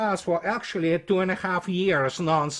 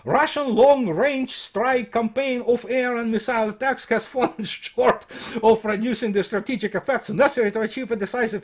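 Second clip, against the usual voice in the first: reading out a report, slightly raised; report read aloud; 6 semitones higher than usual. Acted. Commentator reads critics' report to his audience, formal read-aloud.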